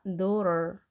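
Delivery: "daughter" as dorer, silent GH. Shown as a demonstration. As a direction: put an R sound between the vowels of "daughter". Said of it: "R 발음을 하시면 되겠습니다". ("daughter"의 GH는 묵음, T는 R로 발음)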